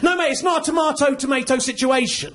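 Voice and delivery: ordinary delivery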